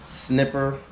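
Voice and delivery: "Snipper" with a short i; "Snipper" with short-i first syllable; misread sniper. Real, not acted. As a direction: say "Snipper", rhyming with dipper. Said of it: "I think that's Sniper".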